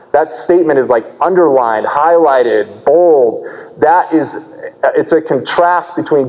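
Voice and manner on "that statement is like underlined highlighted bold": usual speaking voice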